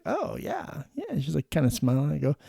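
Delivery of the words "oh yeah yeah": higher, pleased "oh, yeah, yeah"; pleased student. Retold or imitated. Nursing student told she acts like a hardened nurse, pleased high reply. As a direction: imitate her taking the jab as praise. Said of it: "And she's like".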